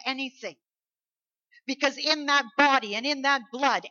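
forceful preaching. Real, not acted. Preacher telling congregation not to fear, forceful. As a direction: preach assurance with full force.

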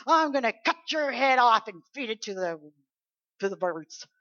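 booming, menacing boast; loud warrior's taunt; loud threat. Retold or imitated. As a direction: bellow it across the battlefield. David threatening Goliath.

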